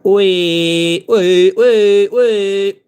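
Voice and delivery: high, sustained playful wees; playful droning squeals; sing-song wee noises. Pretend. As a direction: draw out each wee like a siren. Singing little noises while painting.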